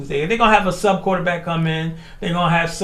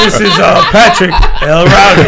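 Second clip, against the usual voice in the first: loud, laughing imitation of an announcer; mock radio voice; 11 dB louder than usual. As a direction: playfully boom out a host's introduction. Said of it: "Hear radio voice".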